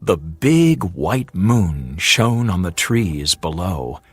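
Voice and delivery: ordinary delivery, nothing marked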